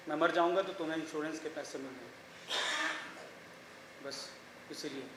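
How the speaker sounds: low, soft and downcast; subdued confession. Acted. Husband admitting his suicide attempt to his wife, subdued confession. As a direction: confess it quietly, sinking almost to nothing.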